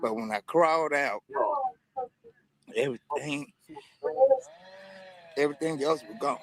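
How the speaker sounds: real emotion, halting with long pauses; choked up. Real, not acted. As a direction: struggle to get the words out. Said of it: "I hate to be an emotional guy like this".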